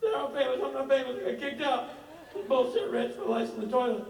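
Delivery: high, loud, whiny mock complaint; whiny Rob. Friend whining to Rich, high and loud.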